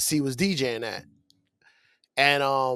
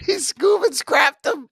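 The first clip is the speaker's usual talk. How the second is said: high, loud, slow and laughing; laughing exclamation; 11 semitones higher, 4 dB louder, slower than usual. Real, not acted. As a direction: crack up while you say it.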